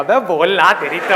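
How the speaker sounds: mock-angry outburst with a loud start; loud, acted demand to speak up; exasperated listener. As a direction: play a fed-up heckler losing it. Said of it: "लोग बौखला जाएंगे".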